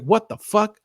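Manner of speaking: high-pitched retold astonishment; incredulous, pitch jumping up; shocked disbelief. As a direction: blurt it out as a stunned realization. Retells shocked realization.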